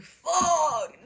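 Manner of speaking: a loud, high mock shout of dismay; hammed-up shout of frustration; frustrated curse. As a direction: yell it like the plan just died. Sparta refuses, Aristagoras despairs.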